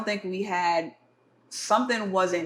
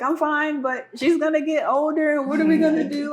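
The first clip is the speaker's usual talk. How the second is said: high-pitched mock-tearful fretting; retold bawling; 8 semitones higher, 4 dB louder than usual. New mom answering her worried partner, retold bawling. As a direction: imitate insisting through tears, without crying. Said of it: "like, just bawling tears".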